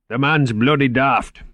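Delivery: slow, put-on Scottish accent; mock Cuthbert in a slow Scottish brogue. Moriarty mocks his boss Cuthbert to Margot, slow Scottish accent. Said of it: "Moriarty imitated Cuthbert's Scottish accent".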